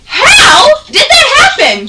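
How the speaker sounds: high, loud startled question; sudden loud surprise. Real, not acted. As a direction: caught off guard, blurting it. Teacher startled by something.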